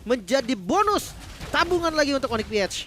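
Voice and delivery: loud, high, fast esports call; excited play-by-play. Real, not acted. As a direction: call the fight at full hype. (komentar heboh penuh semangat)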